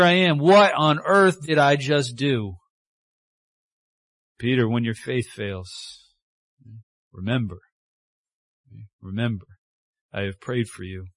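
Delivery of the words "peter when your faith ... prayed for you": soft, low voice of reassurance; tender Jesus. Acted. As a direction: speak it gently, like a promise. Jesus speaking tenderly to Peter.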